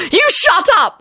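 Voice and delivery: high furious wail. A narrator's reading. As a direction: wail at him, mortified. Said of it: "Elizabeth wailed".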